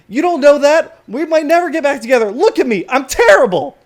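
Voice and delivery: high, loud outburst of self-reproach; distraught yelling. Acted. Watchman tells colleague about his failing marriage, yelling. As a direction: wail at full volume that all is lost.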